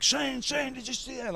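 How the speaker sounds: high, eager mimicked call; Pat Patterson. Backstage cheerleader grabbing Shane's attention, excited. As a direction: imitate an excited cheerleader, pitch way up. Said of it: "like, my biggest cheerleader in that moment".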